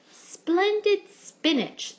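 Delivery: high, loud, slow principal praise; hearty principal. A narrator's reading. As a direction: savour each word like a proud principal. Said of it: "said the principal".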